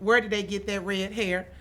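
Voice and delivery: ordinary delivery, nothing marked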